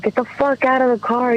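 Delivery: high-pitched furious yell at him; angry yell. Retold or imitated. Screaming at husband during fight.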